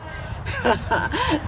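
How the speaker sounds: soft chuckle between lines of a poem; amused laugh. Real, not acted. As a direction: laugh briefly mid-recitation.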